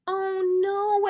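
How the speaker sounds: loud, high gasped oh no; dismayed gasp. A narrator's reading. Mother realizing the tickets are missing, gasping loud. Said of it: "Ava's mother gasped".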